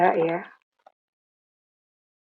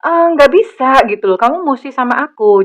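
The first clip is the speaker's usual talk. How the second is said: half-playful panicked protest; mock clingy protest; 3 semitones higher, 6 dB louder than usual. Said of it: "Dia kelimpungan kayak". (protes panik yang dibuat-buat)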